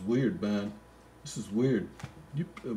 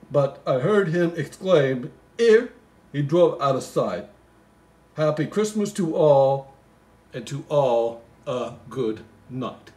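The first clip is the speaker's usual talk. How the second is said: reads the Christmas poem aloud, a bit louder; poem reading; 3 semitones higher, 6 dB louder, slower than usual. Acted. Comic reader reciting the Christmas poem, lightly sing-song. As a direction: recite the verse with a touch of storybook lilt.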